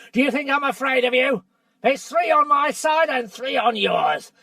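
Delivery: dwarf shouting down the drawn sword; angry defiance. Acted. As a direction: dare him to strike. Trumpkin defies Caspian at sword.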